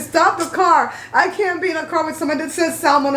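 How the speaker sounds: mock outrage, pitched high; hammed-up high-voiced demand to get out; overly dramatic. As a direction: imitate a drama queen refusing to ride along. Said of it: "He was being overly dramatic".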